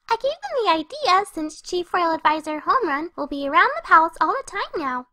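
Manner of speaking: ordinary delivery, nothing marked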